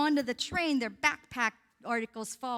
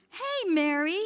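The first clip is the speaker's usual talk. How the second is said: calling out; 6 semitones higher, 5 dB louder, slower than usual. Retold or imitated. Man calls out to Mary.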